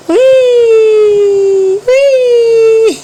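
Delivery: high, loud, drawn-out hooey. Real, not acted. Mother calls to her baby in a high, stretched playful call.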